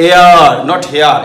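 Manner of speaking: এয়ার said with no h, set against হেয়ার with a clear h; এয়ার with a silent h, slowly. Shown as a demonstration. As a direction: drop the h in এয়ার, not হেয়ার. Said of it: "এটা উচ্চারণ".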